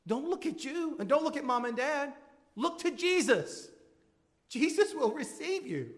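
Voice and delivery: urgent pulpit plea, high and loud; fervent preaching. Acted. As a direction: cry out to the worried to look to Jesus. Urgent plea to worried listeners.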